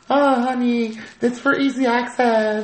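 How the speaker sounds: high, drawn-out sweet-talk, imitating a girlfriend; cooing girlfriend. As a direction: put on a high mock-girlfriend voice. Acting girlfriends' excuse, high cooing.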